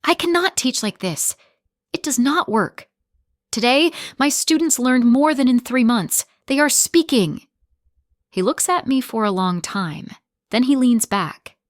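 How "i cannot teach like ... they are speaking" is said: mildly raised, a touch of tremble; understated shaky defiance; shaking voice. Acted. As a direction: push through nerves, a hint of shake. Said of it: "My voice shakes".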